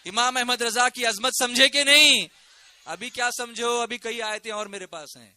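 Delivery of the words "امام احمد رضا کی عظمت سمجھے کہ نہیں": loud, ringing questions; rousing challenge. Real, not acted. Preacher checks congregation's renewed faith, rousing and loud. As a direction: demand a yes from the crowd.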